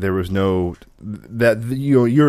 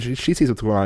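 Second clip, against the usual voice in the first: usual speaking voice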